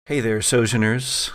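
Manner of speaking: ordinary delivery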